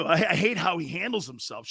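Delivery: ordinary delivery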